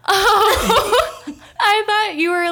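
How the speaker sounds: ordinary talk, nothing marked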